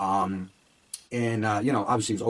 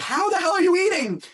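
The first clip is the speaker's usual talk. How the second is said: incredulous outburst; 6 dB louder than usual. Retold or imitated. Shocked at Charlie's huge meal.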